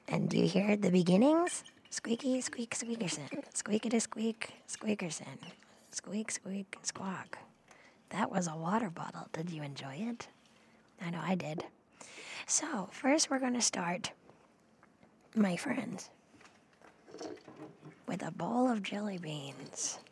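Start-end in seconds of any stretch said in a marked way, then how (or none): 1.9-11.6 ASMR whisper. Acted. 18.1-20.0 whisper. Shown as a demonstration. Whispered take of phrase.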